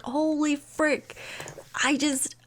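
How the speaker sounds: slow, stunned mock panic; a touch of hammed-up dread; panicked. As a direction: draw it out in acted shock. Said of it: "in a freaking panic".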